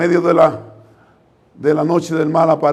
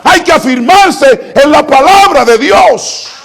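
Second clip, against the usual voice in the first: much higher, louder fervent preaching; preaching at full force; 8 semitones higher, 7 dB louder than usual. Acted. Preacher urging listeners to believe, fervent and loud. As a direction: proclaim it with mounting fervour, slow at the end. Climax of the sermon.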